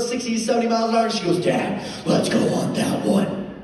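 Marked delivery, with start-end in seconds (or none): 1.4-3.5 put-on slow, menacing demon-child voice; hammed-up creepy kid demand; scary savage kid. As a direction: drag it out like a possessed little monster. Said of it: "Stop talking like that. You're scaring me".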